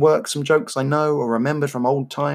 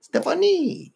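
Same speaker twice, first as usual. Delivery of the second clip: high, far-off mock French; hammed-up French name call; French accent; 14 semitones higher, slower than usual. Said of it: "then you did a French accent".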